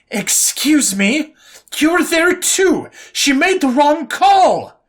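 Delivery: high, slow, indignant near-yell; officer snapping at an insolent private. A narrator's reading. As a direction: nearly yell it back in outrage. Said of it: "she nearly yelled".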